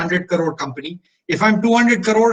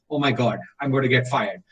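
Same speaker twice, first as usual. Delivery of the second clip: panicked thought; 5 semitones lower, 4 dB quieter than usual. Retold or imitated. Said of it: "I was like".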